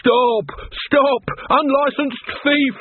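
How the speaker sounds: high-pitched cry after a thief; hammed-up high shout, last words drawn out; acted alarm call. A narrator's reading. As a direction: call out high and urgently as a robbed shopkeeper. Robbed man calls after thief.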